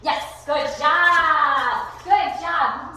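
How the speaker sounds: big, stretched-out cheer; delighted praise. Real, not acted. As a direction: gush praise at a dog. Praising dog for calming.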